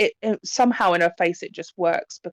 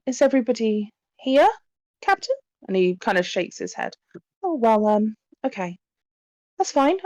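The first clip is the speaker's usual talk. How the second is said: put-on higher-pitched tentative young medium; hesitant, polite medium; 3 semitones higher than usual. Medium inviting guests to introduce themselves, politely hesitant. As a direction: ask gently, with little uncertain stops.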